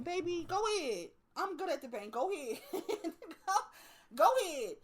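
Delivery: quiet, easy-going wave-off; soft yielding. Retold or imitated. She lets the angry woman cut the bank line, quietly. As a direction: let her go first, softly.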